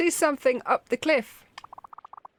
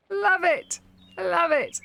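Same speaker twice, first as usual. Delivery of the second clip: delighted; 9 semitones higher, 4 dB louder, slower than usual. Real, not acted. Cheering on the meditating cat.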